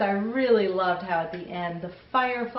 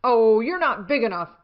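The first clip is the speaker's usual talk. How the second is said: loud, huffy brush-off, louder; a grouchy little bug waving off a rival, loudly; grouchy ladybug; 4 semitones higher, 7 dB louder than usual. Acted. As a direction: scoff at the bigger bug, loud and proud. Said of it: "said the grouchy ladybug".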